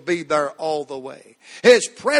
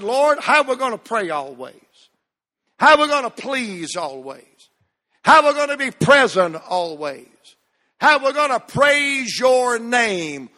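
raised, pleading pulpit questions; fervent, repeated sermon cadence; impassioned preaching; 7 semitones higher, 4 dB louder than usual. Real, not acted. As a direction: cry each question out to the congregation.

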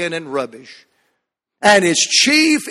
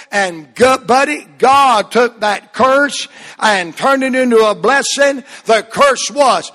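triumphant preaching; 3 semitones higher than usual. Acted. Preacher celebrating the rebuilt walls, triumphant preaching. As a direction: proclaim the victory like a revival preacher. Preacher climaxes Nehemiah story.

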